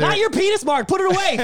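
loud, high-pitched comic outburst; mock outraged shout. Co-host reacting to the head reveal, mock horrified yell. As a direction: yell it in pretend horror. Joking outburst at the other speaker.

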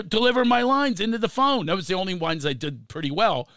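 ordinary talk

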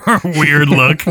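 amused repeat through laughter; laughing. Real, not acted. Co-host teases the other's awkward phrase, laughing. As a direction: echo it while cracking up.

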